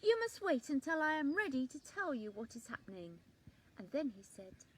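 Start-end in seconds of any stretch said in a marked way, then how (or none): 0.0-3.2 loud, fast, bossy order; rude and bossy. A narrator's reading. Rude leaf bossing the others, loud and quick. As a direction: order them to wait. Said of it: "the leaf was very rude".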